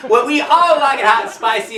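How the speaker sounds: ordinary delivery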